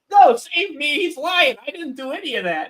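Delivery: very high, panicky begging voice; pleading character voice. Retold or imitated. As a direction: beg for your life in a squeaky voice. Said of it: "And then the other guy's like".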